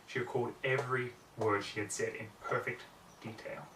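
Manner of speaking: ordinary delivery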